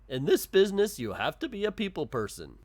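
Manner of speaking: lightly voiced shopkeeper, high and loud at first; put-on shopkeeper voice. Shopkeeper advising the fired worker, lightly put-on voice. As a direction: start high and loud, then settle. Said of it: "I did a voice".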